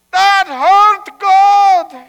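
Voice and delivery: high, loud cry of complaint; pained yell. Acted. Rabbi complaining to God about his hurt foot, yelling. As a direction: yell it upward in pain. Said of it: "he yelled in the ceiling".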